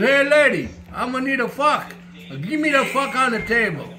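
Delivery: hammed-up Italian guy in a joke. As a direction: play the fresh-off-the-boat Italian, high and brash.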